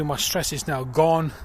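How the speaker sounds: ordinary talk, nothing marked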